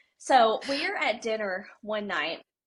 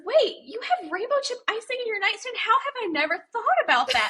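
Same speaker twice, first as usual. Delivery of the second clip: high, fast, wide-eyed friend; envious amazement; 11 semitones higher, faster than usual. Retold or imitated. Abby at dinner, thrilled by the icing stash, high and fast. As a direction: gasp with delighted envy.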